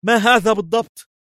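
very high-pitched, startled exclamation; alarmed cry. A narrator's reading. Levi sprayed in the face, crying out. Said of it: "وسعل ليفي وهو يهتف".

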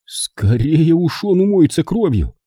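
high, intense vow; grim, resolute threat; grim promise. A narrator's reading. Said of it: "мрачно пообещал".

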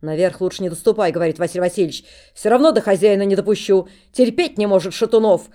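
loud, firm ban; stern warning. Acted. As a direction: lay down the law. Steward forbids loudly.

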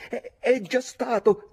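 high, loud, drawn-out cry; stammered shout. A narrator's reading. Young narrator ignores warnings and insists, shouting. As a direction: stumble into a defiant yell.

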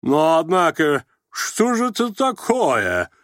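slow, high-pitched indignation; menacing, angered exclamation. A narrator's reading. Angered old man advancing on Rogozhin, menacing outburst. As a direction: draw out the outraged question. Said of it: "грозно и внезапно воскликнул рассердившийся".

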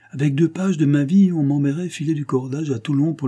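emphatic boast of a dark past. A narrator's reading. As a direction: build it up like a boast. Narrated bandit boasting of his past.

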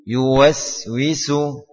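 Yuwaswisu, slow with a long hissing s. Shown as a demonstration. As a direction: draw out the hissing s. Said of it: "Kita baca contohnya". (desis sin panjang)

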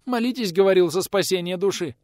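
high-pitched anxious answer; frightened babble. A narrator's reading. Youngest woman quotes the priest to the visitor, frightened. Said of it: "испуганно залепетала в ответ самая молодая".

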